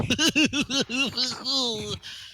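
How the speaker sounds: long high-pitched laugh; acted troll laugh. Troll laughing at John in chat, acted laugh.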